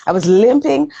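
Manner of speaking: LIMPING pushed up high; stress on LIMPING. Real, not acted. Telling how her body was failing, emphatic high LIMPING.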